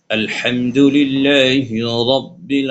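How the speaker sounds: slow, chanted Quranic recitation; measured tajweed chant. Shown as a demonstration. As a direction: recite it like tilawat, drawing out the vowels. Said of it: "এই যে টানগুলো দিলাম".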